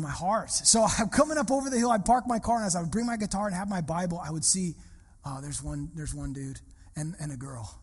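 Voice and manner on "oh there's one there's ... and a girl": much softer, quieter, deflated tally; disappointed aside. Retold or imitated. Pastor spotting only two students, muttered softly. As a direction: count the two people under your breath. Noticing the tiny audience.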